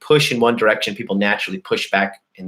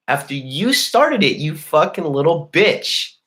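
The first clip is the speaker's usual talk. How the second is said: higher-pitched, exasperated outburst; indignant rant; 6 semitones higher than usual. Real, not acted. Venting at the man who started the fight, indignant high outburst. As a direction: voice climbs in indignant disbelief.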